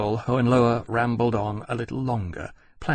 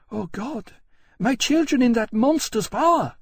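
queen's anguish; 13 semitones higher, 3 dB louder than usual. A narrator's reading. Queen fearful for children.

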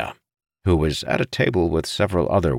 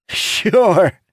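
high, loud, mocking one-word jab; amused disbelief; 7 dB louder than usual. A narrator's reading. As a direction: toss it out high and loud, scoffing playfully. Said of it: "Dougal laughed".

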